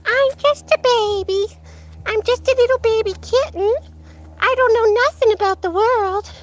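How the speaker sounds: put-on high, cutesy baby kitten voice; funny Lucky voice. Owner voices the cat for livestream viewers, funny high baby voice. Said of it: "the funny Lucky voice. He goes".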